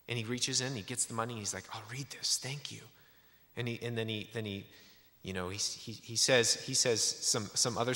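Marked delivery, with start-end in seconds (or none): 1.7-2.8 whispered, hushed acceptance; whispered thanks. Retold or imitated. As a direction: whisper it like a private promise. Stranger quietly accepting the book.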